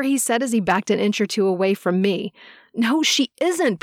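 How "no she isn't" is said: higher, slow, lightly voiced bellow. A narrator's reading. Peter bellows to defend Vicky, a mild higher shout. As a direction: cut in hard to protect her. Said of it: "Peter bellowed".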